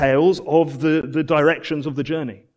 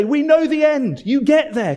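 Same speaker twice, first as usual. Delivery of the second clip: high, ringing encouragement; preacher calling out hope to the wanderers; encouraging call; 10 semitones higher, 4 dB louder than usual. Acted. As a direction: cheer them on toward the finish. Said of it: "We'd say".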